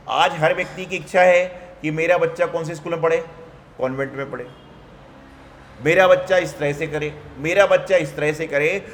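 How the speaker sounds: normal talking voice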